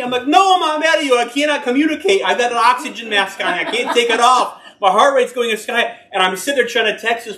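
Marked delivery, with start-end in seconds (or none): 0.3-6.0 high, loud, desperate protest; frantic reply. Retold or imitated. Patient on oxygen answering a church member, loud and frantic. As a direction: insist you can't talk, panicking.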